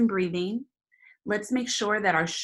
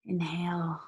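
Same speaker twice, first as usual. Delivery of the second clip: whispered; 5 dB quieter than usual. Real, not acted. Breathing cue.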